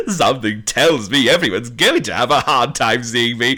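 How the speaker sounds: very high laugh, then laughing through; laughing Frank. Acted. Frank amused at the red walls, laughing. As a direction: chuckle the line.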